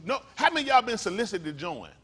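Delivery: normal talking voice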